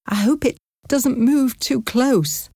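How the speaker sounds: plain reading voice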